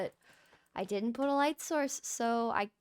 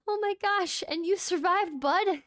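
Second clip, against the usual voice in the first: delighted; 9 semitones higher, 5 dB louder than usual. Real, not acted. Golem survived.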